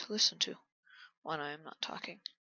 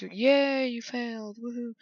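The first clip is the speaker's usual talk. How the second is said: mock cheering; 5 semitones higher, 3 dB louder, slower than usual. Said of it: "everybody's like".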